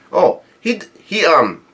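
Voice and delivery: ordinary delivery, nothing marked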